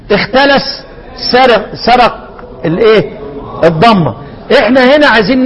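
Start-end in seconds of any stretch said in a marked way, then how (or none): none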